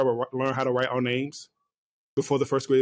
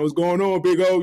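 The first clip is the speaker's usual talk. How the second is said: playful greeting; 4 semitones higher, 4 dB louder than usual. Pretend. Imagined greeting to son.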